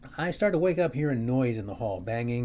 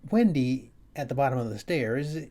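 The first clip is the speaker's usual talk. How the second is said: usual speaking voice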